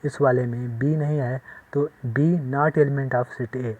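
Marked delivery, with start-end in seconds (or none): 2.1-3.7 slow, drawn-out reading aloud; slow model reading. Shown as a demonstration. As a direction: show the class how it is read. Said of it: "ऐसे लिखेंगे".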